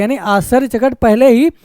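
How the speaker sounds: ordinary talk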